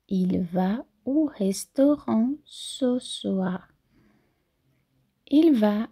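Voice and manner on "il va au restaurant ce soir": slow, drawn-out repeat. Shown as a demonstration. Teacher repeating for students, very slow. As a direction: spell it out slowly. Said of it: "é importante trabalhar a fonética".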